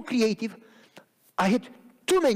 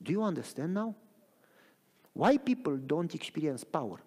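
usual speaking voice

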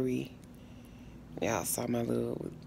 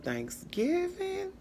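drawn-out exclaim; slower than usual. Real, not acted. Sighing over holiday eating.

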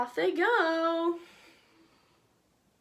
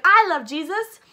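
loud devotion; 5 semitones higher, 13 dB louder than usual. Acted. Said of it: "the paralyzed man's like".

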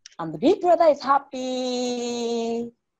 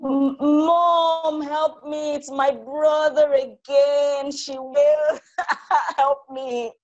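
high, whiny crying call for mom; a child wailing for help, acted; mock tearful plea; 7 semitones higher, much slower than usual. A narrator's reading. Little sister running to mom, fake tearful wail. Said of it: "Minnie burst into tears".

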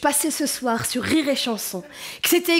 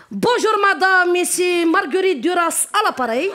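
telemarketer voice; 3 semitones higher than usual. Retold or imitated.

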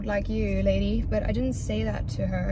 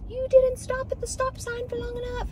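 mock yelling woman. Mimicking the lady who yelled over a stop sign, mock scolding. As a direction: mimic a shrieky telling-off in a high voice. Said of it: "yelling at me".

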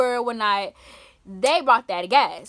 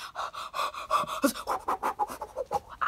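high breathless gasp in shock; sharp gasp; 9 dB quieter than usual. Retold or imitated. First hit of the blunt, a sharp shocked gasp. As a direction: suck in air like you just got hit. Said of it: "I was like".

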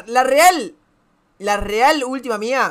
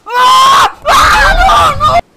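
very high, loud screaming; full-voiced shriek of dismay; falling scream; 10 dB louder, much slower than usual. Real, not acted. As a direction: scream in panic into "no". Falling in the game.

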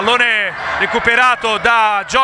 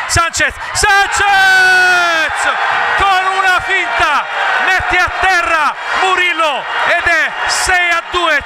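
goal scream; 5 semitones higher, slower than usual. Real, not acted. Goal call in futsal commentary.

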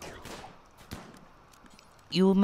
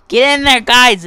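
louder cheer; 6 semitones higher, 17 dB louder than usual. Real, not acted. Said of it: "cheering units on".

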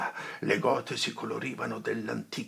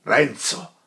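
stern warning; 4 semitones higher, 10 dB louder than usual. Acted. Friar checks Renzo sternly.

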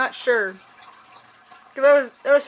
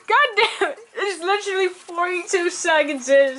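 pitched up, cracking up; laughing; 5 semitones higher than usual. Real, not acted. Reacting to a funny video, laughing. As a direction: talk through real laughter.

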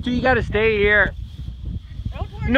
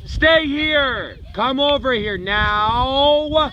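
calling out; 8 semitones higher, slower than usual. Real, not acted. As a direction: call the dog over firmly. Calling dogs on beach.

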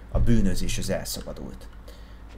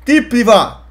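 furious, much higher and louder yell; angry shouting; 13 semitones higher, 13 dB louder, slower than usual. Acted. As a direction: scream it out in a rage. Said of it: "Nem ordibálsz".

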